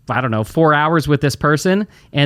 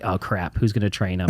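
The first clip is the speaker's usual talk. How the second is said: low, softer mock realisation; mock dismay; 8 semitones lower, 6 dB quieter than usual. Owner realises nobody will train the new hire, low and soft. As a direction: sink into a quiet oh-no.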